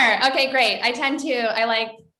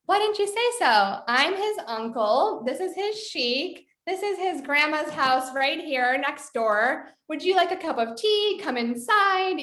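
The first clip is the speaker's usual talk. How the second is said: warm welcoming uncle, higher and unhurried; very inviting; 6 semitones higher than usual. Retold or imitated. Uncle welcoming film crew in for tea, warmly inviting. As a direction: open the door to the stranger, delighted. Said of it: "like, very inviting".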